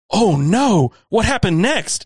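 mock the scripted interjection in a gasping voice; sarcastic imitation of a podcast cohost acting shocked. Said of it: "She's like".

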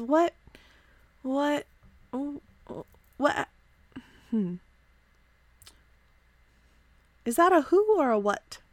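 put-on soft, puzzled young voice with a tilted-head lilt; a curious elf questioning the bottles; Shael curious.

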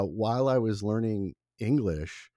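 usual speaking voice

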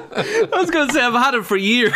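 high-pitched, breaking up with laughter; laughing. Real, not acted. Amused by own answer about colour deficiency, laughing. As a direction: squeeze the words out through giggles.

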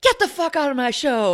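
high-pitched imitation of a rock singer's yell; mock scream. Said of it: "Like, he just screamed".

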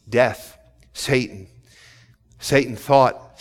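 ordinary delivery

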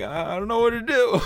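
put-on high, whimpering kid's voice; panicked little kid. Retelling getting lost at Kmart, high panicked kid voice. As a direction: play your scared child self, high and thin. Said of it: "I'm like".

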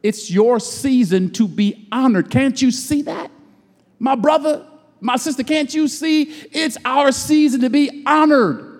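rising preacher's call to the congregation; preacher urging brothers and sisters, rising cadence; preaching cadence. Real, not acted. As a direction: lift it up like a pulpit appeal. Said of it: "Oh, I gotta talk like this".

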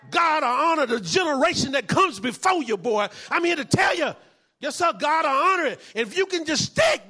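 loud, high, hammering sermon delivery; full-force preaching. Real, not acted. Pastor exhorting the congregation, loud climactic preaching. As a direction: whip up the church with every line.

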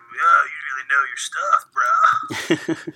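laid-back surfer dude drawl, lightly done; eighties surfer voice. Retold or imitated. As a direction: toss it off like a lazy beach bro. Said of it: "that shitty eighties, like, surfer voice".

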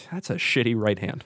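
low, quiet muttering; muttered critique. Acted. Lone viewer judging a street fight video, low muttering. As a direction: mutter it under your breath to yourself.

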